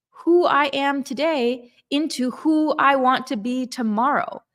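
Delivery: usual speaking voice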